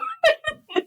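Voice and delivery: high teary laugh; emotional laugh. Real, not acted. Embarrassed laugh after getting emotional with the listener. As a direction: laugh it off through tears. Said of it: "I'm getting emotional".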